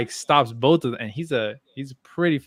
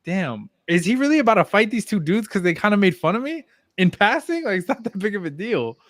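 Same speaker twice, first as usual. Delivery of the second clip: 1 incredulous; 7 semitones higher than usual. Retold or imitated. Retold disbelief at friend fighting.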